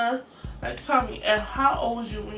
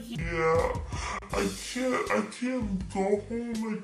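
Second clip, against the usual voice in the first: dazed and numb; slower than usual. Retold or imitated. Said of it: "And I was like".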